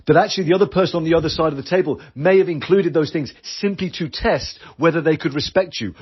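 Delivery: ordinary talk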